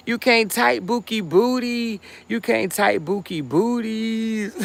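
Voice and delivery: high, playful mocking chant; sing-song taunt at the chatter; high teasing playground chant; teasing singsong.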